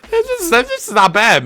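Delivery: high, squeaky disbelief; pleasantly surprised. Real, not acted. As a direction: stammer high in rising hope. Pleased by promising lineup.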